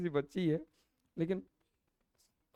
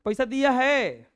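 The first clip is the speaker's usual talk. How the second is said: louder and higher, demanding; loud mock yell; shouting girl; 6 semitones higher, 6 dB louder, slower than usual. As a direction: yell it like a fierce little girl. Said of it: "वो एकदम चिल्ला के बोल रही थी".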